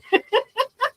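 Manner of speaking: short high giggle; high little laugh. Real, not acted. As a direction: giggle at the mix-up. Laugh after thanks.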